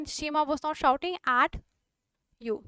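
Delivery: धीमे, शब्द-दर-शब्द; "shouting" पर हल्का ज़ोर, धीरे; slow dictation. Shown as a demonstration. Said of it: "teacher dictates slowly". (लिखवाते हुए धीमी आवाज़)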